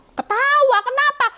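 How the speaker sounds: high, leaping teasing question; mock amused reply. God teasing the believer who now laughs, high-pitched. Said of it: "Tapi sekarang kamu ketawa ndak?".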